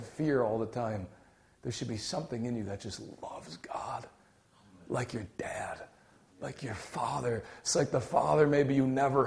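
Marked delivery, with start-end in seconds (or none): 1.6-7.4 whispered, intimate and tender. Real, not acted. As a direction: breathe it as a secret of love. Preacher drops to intimate tone.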